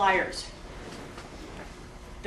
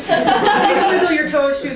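laughing; 4 semitones higher, 8 dB louder than usual. Real, not acted.

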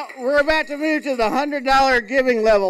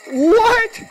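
sudden high, loud "What?"; a startled yelp, pitched way up; surprised; 10 semitones higher, 6 dB louder than usual. Acted. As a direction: blurt it out in loud disbelief. Mock shock at the coonskin hat.

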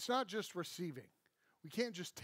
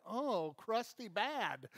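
slow, higher sneering "oh, crusty bad"; crusty judgment; 4 semitones higher, much slower than usual. Scornful churchgoer sneering at a sinner, slow and high. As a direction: drag it out like a sour old believer. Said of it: "And you're like".

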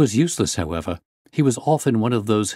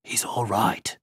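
whispered reassurance; 4 dB quieter, slower than usual. A narrator's reading. Guard reassures the doctor about his charge, whispering. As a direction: breath-only whisper. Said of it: "he whispered".

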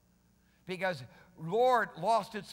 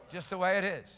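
ordinary talk, nothing marked

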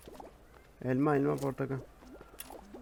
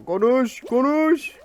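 loud, much higher, strained shout; frustrated yell; 11 semitones higher, 11 dB louder, much slower than usual. Real, not acted. Gamer yelling at his character to talk, loud and high. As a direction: yell it at the screen.

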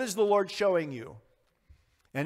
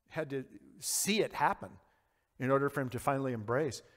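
ordinary delivery

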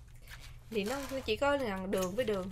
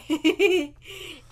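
tiếng cười; 6 semitones higher, 7 dB louder than usual. Real, not acted. Cười tiếp.

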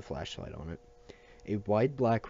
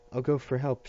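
higher, louder call for help; shouted; 5 semitones higher, 4 dB louder than usual. A narrator's reading. Fidelio shouting into Matt's ear, loud. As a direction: shout it close into a friend's ear. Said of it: "shouted Fidelio into his ears".

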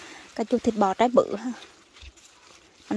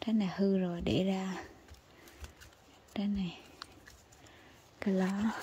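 whisper; 11 dB quieter than usual. Real, not acted. Quiet aside while picking.

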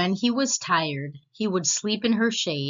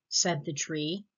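ordinary delivery, nothing marked

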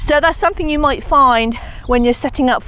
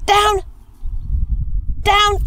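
sharp command; 10 semitones higher, much slower than usual. Real, not acted. Commanding dog to drop.

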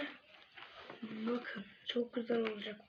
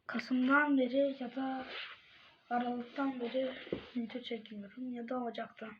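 normal talking voice

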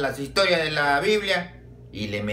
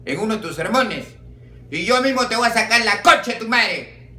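mild at first, then loud and high, fuming; furious boss dressing down his priest; bishop's scolding; 6 semitones higher, 3 dB louder than usual. Acted. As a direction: tear into him harder line by line.

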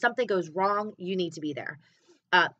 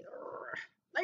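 heartbroken groan; 8 semitones lower, 14 dB quieter than usual. Retold or imitated. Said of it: "I'm like".

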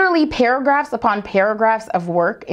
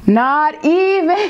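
slow, drawn-out disbelief; incredulous; 6 semitones higher, much slower than usual. Real, not acted. Teacher reacting to a clip, slow disbelief. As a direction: stretch it out in disbelief.

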